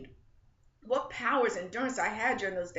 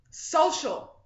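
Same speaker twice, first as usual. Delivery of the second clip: higher, louder yell of social; 7 semitones higher, 7 dB louder than usual. A narrator's reading. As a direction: throw out the word Social at full voice. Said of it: "yelled out a phrase".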